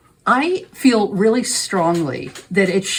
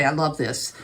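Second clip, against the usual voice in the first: ordinary talk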